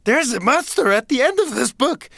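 high, alarmed Muppet-monster warning; scared Grover. Retold or imitated. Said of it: "'cause he's afraid".

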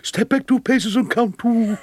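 mock stage call, pitched high and carried through; John Gielgud. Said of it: "he shouted out down to me".

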